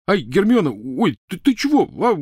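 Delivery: high, loud cries under a beating; jolted, high-pitched protest; startled yelping. Acted. Ron being beaten by Hermione.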